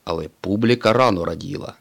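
ordinary delivery

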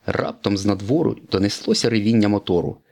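usual speaking voice

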